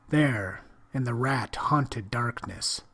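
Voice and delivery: usual speaking voice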